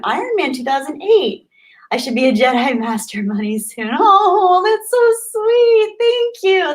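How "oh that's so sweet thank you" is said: high, slow, gushing squeal of delight; real joy spilling out, much higher; touched and squealing; overwhelmed squeal. Real, not acted. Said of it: "little personal freak out moment".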